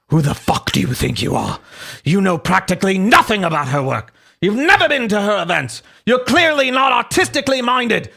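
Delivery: hammed-up offended Brit, building; British voice. Host as Buckby scolding Lewis about her work, indignant British voice. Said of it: "read Buckby's voice in a British voice".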